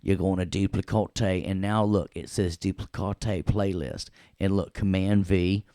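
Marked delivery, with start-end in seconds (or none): 0.5-1.5 "duplicate" said as doo-plih-kaw-TAY; comic doo-plih-kaw-tay for "duplicate"; playful mispronunciation. Pretend. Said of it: "way more fun to say duplicate". 2.6-3.5 "duplicate" said as doo-plih-kaw-TAY; comic doo-plih-kaw-tay for "duplicate"; playful mispronunciation. Pretend. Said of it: "way more fun to say duplicate".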